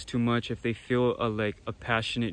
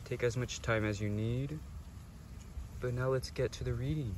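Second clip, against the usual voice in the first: soft guided meditation; 2 semitones lower, 9 dB quieter than usual. Acted. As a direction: gently guide them through the choice. Soft stone-selection meditation segment.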